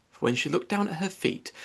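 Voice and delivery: plain reading voice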